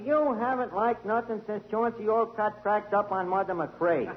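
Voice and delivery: normal talking voice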